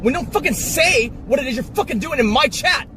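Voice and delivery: high-pitched, ranting frustration; exasperated scolding. Acted. Streamer telling off chat for causing chaos, exasperated scolding. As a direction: lay into them, hitting the swear words.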